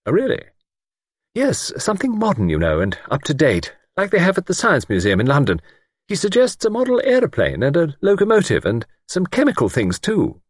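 high, chattering lady's voice; lightly voiced eager village spinster; fluttery spinster. A narrator's reading. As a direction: prattle on eagerly. Miss Wainfleet voiced high.

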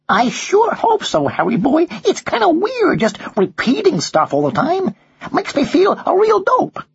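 put-on high-pitched parrot with an American accent; squawky, drawling American bird. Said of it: "with a distinct American accent".

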